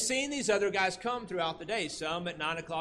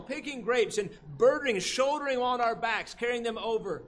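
outraged workers; 5 semitones higher than usual. Retold or imitated. Day laborers complaining to the landowner, outraged shout. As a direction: build the grievance like tired laborers. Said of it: "They're outraged".

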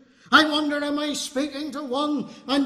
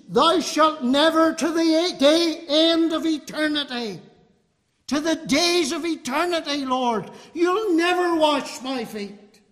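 higher-pitched, forceful refusal leaning on "never"; tremendous emphasis; 4 semitones higher, 3 dB louder than usual. Acted. Peter vowing to the Lord, "never" said with great emphasis. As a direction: insist emphatically, drawing out "never". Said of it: "There's a tremendous emphasis".

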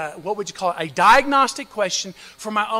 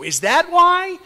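ordinary delivery

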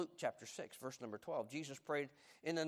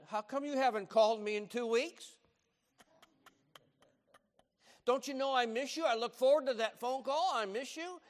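higher, louder hurt scolding grandma; scolding grandmother; 10 semitones higher, 8 dB louder than usual. Retold or imitated. Italian grandmother scolding grandson for not calling, high and hurt. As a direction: scold him like a grandma who misses him. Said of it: "She said".

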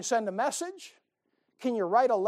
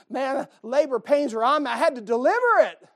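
mock labor excitement; 5 semitones higher, 9 dB louder than usual. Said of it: "You say, What?".

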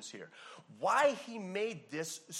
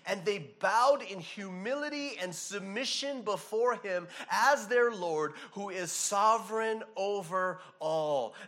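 preaching at full force; 2 semitones higher, 7 dB louder, slower than usual. Acted.

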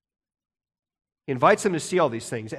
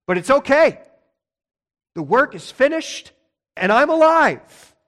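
higher and louder, triumphant news; joyful Jesus; 7 semitones higher, 4 dB louder than usual. Acted. Jesus telling fearful disciples he is alive, triumphant. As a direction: lift it up, proclaim he lives. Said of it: "And he says".

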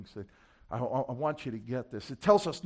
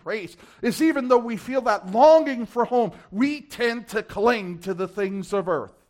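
raised, driving, urgent exhortation; impassioned preaching; 6 semitones higher, 7 dB louder than usual. Real, not acted. Preacher warning believers about clinging to earth, impassioned and raised. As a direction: press the congregation hard, voice high and forceful.

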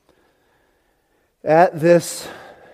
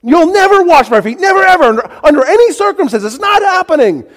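high-pitched shouted refusal; hammed-up, furious absolute no; adamant refusal; 9 dB louder than usual. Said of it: "a radical reversal".

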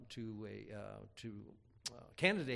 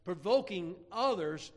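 raised, emphatic pulpit delivery; preaching; 5 semitones higher, 5 dB louder than usual. Real, not acted. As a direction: drive the point home to the congregation. Preaches on the verse.

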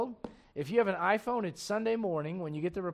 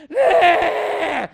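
higher, louder shouted nah; loud dismissal; 11 semitones higher, 12 dB louder than usual. Real, not acted. Pastor brushing off the kids' reply, shouted nah. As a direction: wave it off with a shout.

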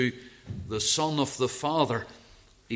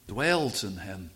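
ordinary talk, nothing marked

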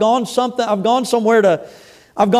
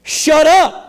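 raised, punchy command; higher-pitched sharp order to be silent; forceful rebuke; 6 semitones higher, 4 dB louder than usual. Acted. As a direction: read it out as a firm rebuke. Reading out a rebuke from scripture.

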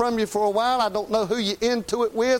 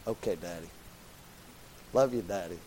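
soft and much lower, a quiet daughter's answer; sweet daughter; 13 semitones lower, 12 dB quieter than usual. Retold or imitated. Preacher voicing his grown daughter answering him, quiet and soft. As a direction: hush down into the grown daughter's dutiful reply.

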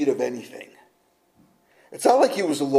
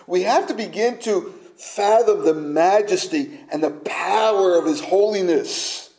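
ordinary talk, nothing marked

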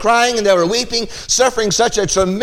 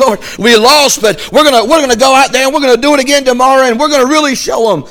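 louder, higher and fast, tumbling out; defiant rallying vow; 5 semitones higher, 5 dB louder, faster than usual. Retold or imitated. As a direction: promise God you'll win tomorrow. Weeping army vows to fight again.

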